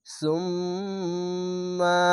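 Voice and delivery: সুম্মা with the doubled m hummed long and soft; ghunna on mm. Shown as a demonstration. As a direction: hold the nasal mm in সুম্মা. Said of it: "এখানে ওয়াজিব গুন্না".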